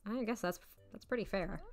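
ordinary talk

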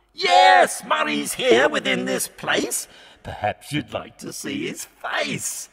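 high, sneering devil's refusal; a devil who scorns the poor; Satan crying out. A narrator's reading. Satan answering Frank.

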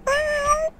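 high-pitched drawn-out meow; cat impression. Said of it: "I'm going to do a cat".

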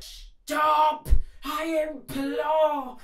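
high shouted plea, slow on implore; desperate cry. Acted. Old lady begging the animals to stop, high and shouting. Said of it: "The little old lady cried".

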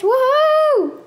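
very high, gleeful whoop; excited whoop. Real, not acted. Player shooting through water with the trident, excited whoop. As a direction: whoop as if launched into the air.

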